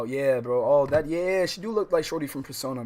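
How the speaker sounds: ordinary talk, nothing marked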